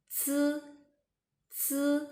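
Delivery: "zi" like the ts ending a word, unaspirated; high, level "zi" with a buzzed vowel; word-ending ts sound. Shown as a demonstration. Teacher modelling from an English ending, unaspirated "zi". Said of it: "almost the same with the ending sound of".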